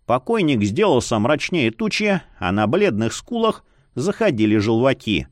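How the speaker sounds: ordinary delivery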